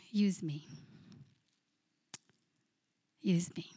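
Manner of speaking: soft whispered prayer, "Use me"; whispered plea. Real, not acted. Pastor ending her prayer, whispered and humble. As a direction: breathe the words like a private prayer.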